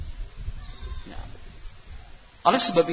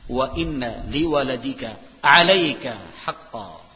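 slow, measured Arabic recitation; hadith recitation; 3 semitones lower, 4 dB louder, slower than usual. Real, not acted. Preacher reminding parents of the hadith, reciting slowly. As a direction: draw out each phrase of the saying.

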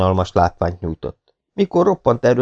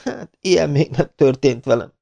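groaning; 7 semitones higher than usual. Acted. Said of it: "nyögte".

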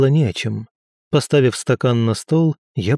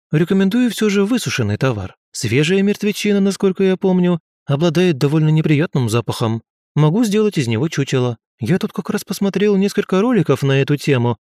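higher-pitched, eager ghost scientist; chatty ghost scientist, businesslike; 5 semitones higher than usual. Acted. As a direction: prattle on like an amateur expert. Ghost scientist chatters about taxidermy. (болтливый призрак-учёный, деловито)

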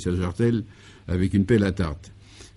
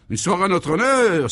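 higher, lightly excited cry of pleasure; delighted exclamation; 9 semitones higher, 4 dB louder than usual. Acted. Guy is delighted to hear of a party in his honour, exclaiming. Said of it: "s'écria Guy".

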